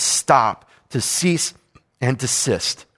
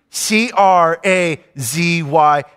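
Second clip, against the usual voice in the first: C R A Z Y spelled slowly letter by letter; slower than usual. Shown as a demonstration. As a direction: spell crazy out, each letter punched. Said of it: "my kids are crazy".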